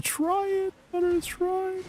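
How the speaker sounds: high, soft put-on voice urging; squeaky urging. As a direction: nudge him in a tiny high voice. Mock voice urging Butters.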